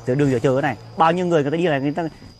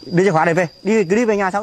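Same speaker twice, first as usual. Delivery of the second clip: loud, higher insistence; raised demand; 4 semitones higher, 4 dB louder than usual. Acted. Husband pressing wife for keys, loud and sharp. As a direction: snap at her to hand them over.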